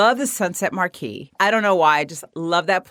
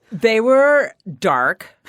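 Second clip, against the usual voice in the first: slow dramatic; 4 semitones higher, 5 dB louder, much slower than usual. Real, not acted.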